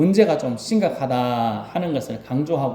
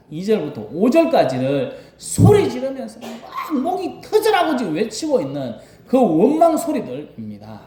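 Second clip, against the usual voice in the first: ordinary talk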